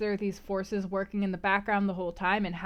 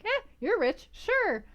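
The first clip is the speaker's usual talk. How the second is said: higher, offhand shrug of a voice; imitating a dismissive Jane; 12 semitones higher, slower than usual. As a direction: brush him off like his money barely matters. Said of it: "Jane was gonna be like".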